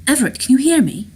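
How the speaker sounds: high, loud worried questions; urgent calling. Acted. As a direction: call anxiously down a phone line. Phone woman calling out to Rourke.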